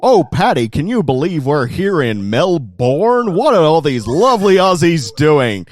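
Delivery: loud, high, gushing American tourist voice; American tourist impression. Australian mocking a tourist in a gushing American accent. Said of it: "you're gonna have someone be like".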